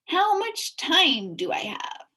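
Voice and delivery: high, anxious bee voice; a fretful insect asking for time; small buzzy voice, worried; nervous bee. A narrator's reading.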